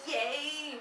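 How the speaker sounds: high, drawn-out cheer of surprise; a demonstrated high yay; surprised yay. Shown as a demonstration. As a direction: pitch a happy yay up high. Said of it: "In surprise, it's all high".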